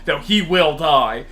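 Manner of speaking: normal talking voice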